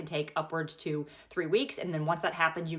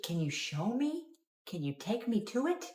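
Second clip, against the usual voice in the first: ordinary talk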